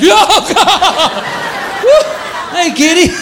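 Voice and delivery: very high startled cries; high shocked squeal then a forced greeting; startled yelp. Retold or imitated. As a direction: jump at the cat on the toilet.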